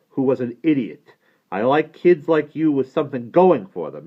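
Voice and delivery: emphatic boss laying down terms; Abe explaining. Acted. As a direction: lay out the deal, brooking no argument. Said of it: "To Alvin he explains".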